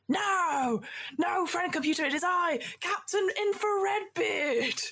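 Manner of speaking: high, proud pirate captain, slowing on his name. Acted. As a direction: announce yourself grandly as a pirate captain. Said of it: "the captain in orange is like".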